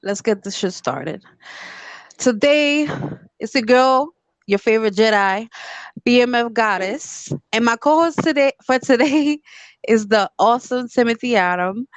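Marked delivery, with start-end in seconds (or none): 2.2-11.9 podcast host intro. Acted. Host introduces guests on show.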